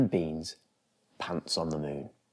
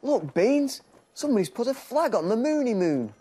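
much higher, louder, quick eager call; excited discovery; 4 dB louder than usual. Acted. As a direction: point it out to the dog in amazement. Zoe spots the flag.

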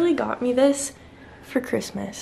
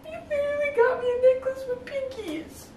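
hurt protest; 10 semitones higher than usual. Real, not acted. Girlfriend protesting the breakup.

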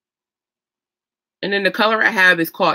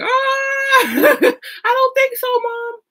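high, loud squealing refusal with a laugh; mock scared refusal; 4 dB louder, much slower than usual. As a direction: squeal no back at mom, pitched way up. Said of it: "I'm like".

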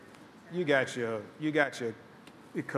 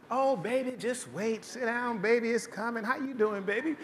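a light, sugary Southern hostess voice, a touch higher and softer; sweet Southern host; 5 semitones higher, 4 dB quieter than usual. Retold or imitated. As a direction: coo at the guest to sit and wait. Southern host soothing waiting guest.

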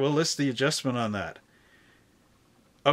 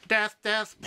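glitching robot; 5 semitones higher than usual. Retold or imitated. Robot sentencing criminal.

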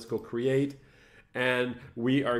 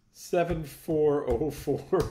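laughing; 3 semitones higher, much slower than usual. Real, not acted. Laughing while reading a process number.

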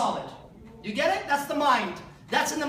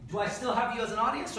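ordinary talk

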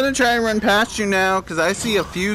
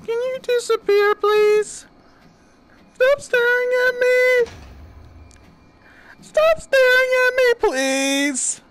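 high, scared pleading; begging her to stop; nervous pleading; 13 semitones higher than usual. Real, not acted. Pleading with the creepy girl.

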